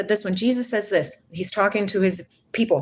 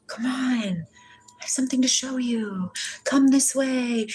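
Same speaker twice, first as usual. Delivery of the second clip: breathy beckoning whisper, higher; mysterious voice; 5 semitones higher, 4 dB quieter than usual. Retold or imitated. Retelling the voice that called her, whispered beckoning. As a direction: coax softly like an unseen voice calling. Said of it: "hearing a voice saying".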